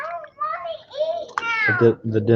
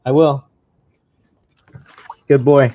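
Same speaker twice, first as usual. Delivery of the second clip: yelling across the room; 3 semitones higher, 7 dB louder than usual. Real, not acted. Dad answering child.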